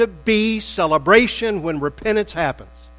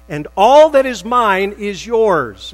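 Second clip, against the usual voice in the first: emphatic father; 5 semitones higher, 10 dB louder, slower than usual. Acted. Father reassuring older son.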